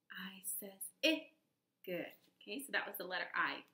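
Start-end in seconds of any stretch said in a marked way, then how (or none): 0.1-1.3 whisper. Shown as a demonstration. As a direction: drop to a whisper for the last go. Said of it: "Again, I says".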